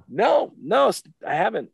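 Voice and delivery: high, loud protesting no; emphatic, high-pitched denial; emphatic retold denial. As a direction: deny it emphatically, pitch jumping up.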